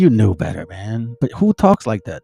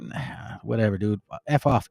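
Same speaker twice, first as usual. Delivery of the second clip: soft, dismissive brush-off; a hint of a sulky brother waving him away; dismissive Cain; 4 semitones lower, 6 dB quieter than usual. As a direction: toss it off, couldn't care less. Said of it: "he's like".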